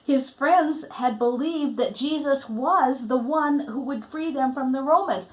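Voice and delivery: ordinary delivery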